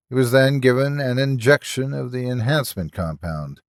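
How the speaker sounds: ordinary delivery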